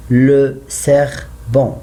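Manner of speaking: le serpent said slowly; slow model. Shown as a demonstration. Teacher models the article slowly. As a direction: give le serpent slowly, word by word. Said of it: "I use the in English".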